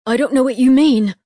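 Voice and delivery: high, loud scared denial; frightened. A narrator's reading. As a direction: deny it in panic. Scared boy denies.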